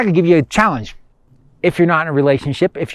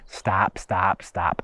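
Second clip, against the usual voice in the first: low, hushed, repeated command; inner voice; 7 semitones lower, 9 dB quieter, much slower than usual. Acted. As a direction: imitate an insistent voice in the head, much softer and lower. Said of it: "What does the voice say?".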